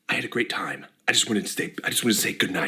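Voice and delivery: low, soft, intriguing narrator; mysterious Vsauce voice. Acted. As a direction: make it sound super intriguing. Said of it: "mysterious voice of Vsauce".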